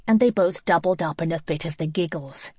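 plain reading voice

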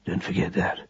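very soft warning; quiet. A narrator's reading. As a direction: let it sink almost to nothing. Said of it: "said Roland quietly".

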